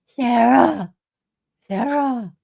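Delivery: mimicked name-call with an Aussie accent. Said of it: "doing it in an Aussie accent".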